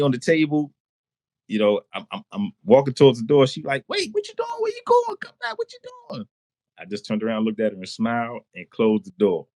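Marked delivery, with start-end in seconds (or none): 3.9-6.3 very high-pitched imitation of a woman; alarmed girl. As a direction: call after someone leaving, in squeaky mimicry. Girl calls after him, baffled.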